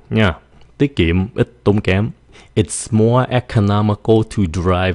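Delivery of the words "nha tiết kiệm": usual speaking voice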